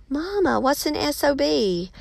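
put-on high-pitched naive child voice; gasp, then ask as a wide-eyed little kid; mock innocent child. Child asking mama about a potty word, mock innocence. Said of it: "they're gonna go home and say".

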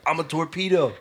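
mock kid battle cry; playful kid. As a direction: shout it like a kid launching into a headbutt. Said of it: "be like".

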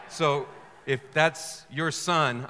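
normal talking voice

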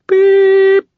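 high electronic beep. A narrator's reading. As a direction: chirp like a machine tone. Voicemail beep.

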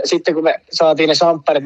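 ordinary talk

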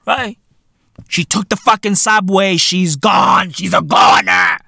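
ordinary talk

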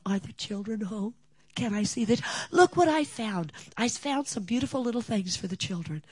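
put-on high, eager elderly neighbor voice; sweet old lady. Neighbor at the door asking for the children, high and eager. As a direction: coo like a doting old neighbor.

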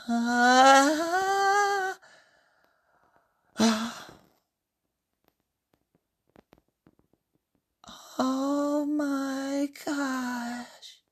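high, drawn-out gasp of wonder; awestruck. Acted. Usuke first seeing Francesca, in awe. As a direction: breathe it out slowly, high and amazed. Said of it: "Usuke is in awe".

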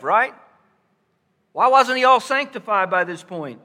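building preacher's challenge; rhetorical preaching questions. Real, not acted. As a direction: press the congregation with questions.

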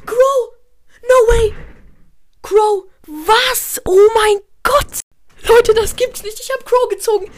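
high shrieking staged disbelief; fake excited screaming. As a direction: scream it like a jackpot win. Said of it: "dass es ein Prank war".

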